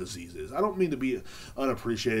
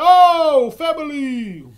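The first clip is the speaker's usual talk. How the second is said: very high, loud, drawn-out cry; mock excited holler; 14 semitones higher, 13 dB louder, much slower than usual. Man spotting family in the photo, mock excited holler. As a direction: sing out the greeting at a yell. Acts out seeing family's house.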